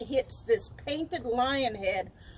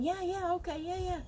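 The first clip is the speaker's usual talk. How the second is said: higher, soft yeah yeahs; distracted yes-yes; absent-minded agreeing; 7 semitones higher, 4 dB quieter than usual. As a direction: nod along without listening. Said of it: "But I'm going".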